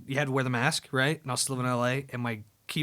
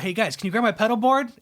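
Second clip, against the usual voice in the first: calling out; 9 semitones higher, 7 dB louder than usual. Retold or imitated. Calling to bandmates across airport.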